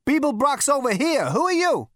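high loud call; shouting. Acted. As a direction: shout back to a stranger. Calling back across corridor.

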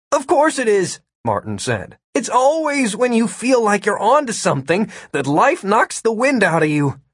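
high, animated character voice; exasperated Martin. Acted. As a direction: voice an exasperated man making his point.

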